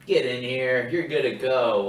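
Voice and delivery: loud, breezy mock recruiter. Mocking easy modern recruiters, loud breezy call. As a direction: boom it out like a lax recruiter. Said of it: "they're waiting out in the sense like".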